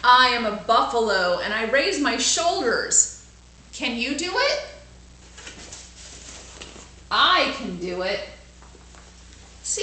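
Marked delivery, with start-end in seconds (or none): 0.0-8.3 put-on bright, louder storybook reading for kids; lively read-aloud. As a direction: read it out big for the class.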